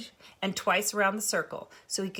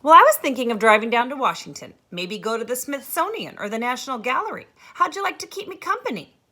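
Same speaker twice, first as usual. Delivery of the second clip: lively teacher; 6 semitones higher, 11 dB louder than usual. Acted. Miss Edmonds on phone.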